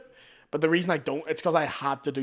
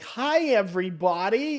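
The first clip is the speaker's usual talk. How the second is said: slow, higher-pitched American YouTuber greeting; kids' American accent; 5 semitones higher, slower than usual. Retold or imitated. Comic imitating kids raised on YouTube, American accent. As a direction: greet viewers in a put-on American twang. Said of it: "So they grow up speaking like".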